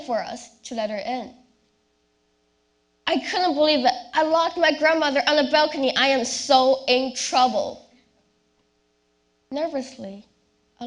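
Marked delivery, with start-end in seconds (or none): 3.1-7.9 loud, fast panicked outburst; panicked disbelief. Acted. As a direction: blurt it out in alarm. Panic at locking grandmother out.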